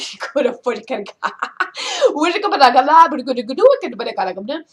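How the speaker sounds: animated, fast gibberish with laughs; complex gibberish. Shown as a demonstration. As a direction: rattle off nonsense words as if telling a story. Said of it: "an example of a little more complex type of gibberish".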